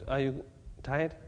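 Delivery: ordinary talk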